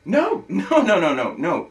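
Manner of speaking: high, loud no's with a scoffing laugh; loud protest. Acted. Arguing with girlfriend on the phone, loud scoffing denial. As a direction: shut her down with a disbelieving laugh.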